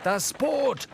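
high alarmed cry of Das Boot; scream. A narrator's reading. Starbuck warns Ahab about his boat, high scream. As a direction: narrator shrieks it as Starbuck. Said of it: "schrie Starbuck".

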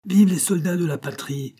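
high-pitched patriotic acclamation; cried cheer. A narrator's reading. Billaud cheering the nation's soldiers, high-pitched cry. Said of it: "cria Billaud".

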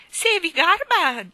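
"Se vi garba" squawked high, loud and slow; cackling old lady. Acted. Miss Calico cackling "Se vi garba" at the children, high loud slow. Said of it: "disse la signorina Calicò schiamazzando".

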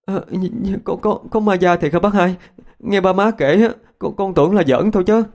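put-on high, faltering, stuttering question of a scared child; stammering in fear. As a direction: stumble over the words, frightened. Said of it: "Tôi miếu máu".

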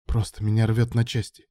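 usual speaking voice

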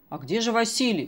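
ordinary delivery

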